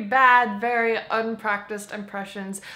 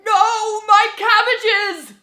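high, loud cartoon cry; anguished merchant's outcry; distraught wail; 9 semitones higher, 8 dB louder, much slower than usual. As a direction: shout in hammed-up despair. Acting the distraught cabbage seller.

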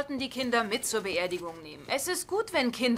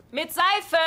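louder and higher, calling across the house; 7 semitones higher, 5 dB louder than usual. Acted. Woman reminding the boy to wash with soap, calling out. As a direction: call out to the kid. Calling to child in other room.

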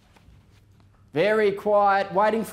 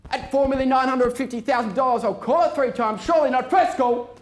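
auctioneer call; 4 semitones higher than usual. Real, not acted. Auctioneer calling the bids.

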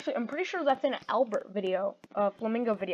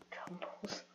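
whisper; 3 semitones lower, 21 dB quieter than usual. Real, not acted. Narrating action under breath.

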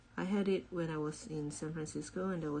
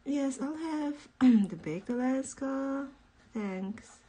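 high, slow, fancy ordering; posh ordering voice; 9 semitones higher, 5 dB louder, slower than usual. Pretend.

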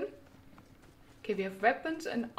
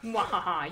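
hammy villain cackle; mock evil laugh; 4 semitones lower than usual. Villain laugh while fighting.

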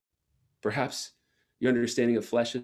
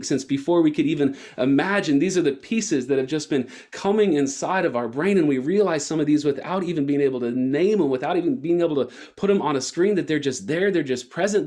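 preaching at full force; 5 semitones higher than usual. Acted. Preacher to the church, building to a fervent climax.

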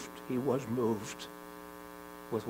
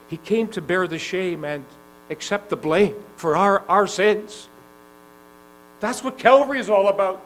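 louder and higher, rising fervor; preaching at full force; 6 semitones higher, 9 dB louder than usual. Acted. Preacher proclaiming the cross to the congregation, mounting force. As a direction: build to the climax. Climax of sermon.

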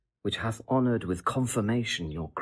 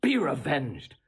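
much higher, heated and insistent; impassioned tirade; 11 semitones higher than usual. Acted. Seducer whips up the princess against her husband, soaring and heated. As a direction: work her up, climbing high with outrage.

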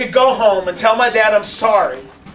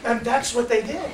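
ordinary talk, nothing marked

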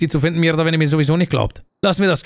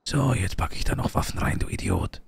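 retold whisper; 7 semitones lower, 9 dB quieter, slower than usual. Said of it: "und gesagt".

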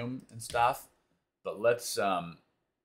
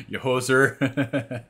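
mock Canadian hoser voice, slow and loud; Canadian accent; 5 semitones higher, 11 dB louder, slower than usual. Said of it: "work on my Canadian accent".

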